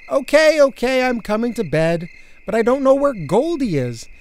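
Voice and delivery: high-pitched, hurried-in giving way; protesting compliance. Acted. Girl giving in to bedtime, high protest. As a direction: give in loudly, then plead your case.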